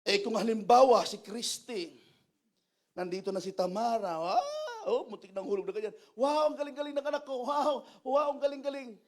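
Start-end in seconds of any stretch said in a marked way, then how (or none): 4.2-9.0 excited cheering. Retold or imitated. Mother cheering child's climb.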